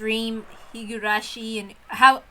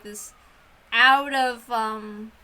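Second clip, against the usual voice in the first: ordinary delivery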